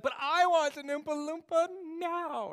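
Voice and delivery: high, loud bratty demand; Veruca Salt. Acted. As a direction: whine it like a demanding brat. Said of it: "Willy Wonka with Veruca Salt".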